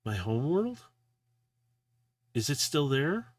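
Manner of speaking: rising, astonished question; amazed, pitch lifting at the end; stunned disbelief. Acted. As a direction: ask it as if hardly daring to believe. Character stunned by map's direction.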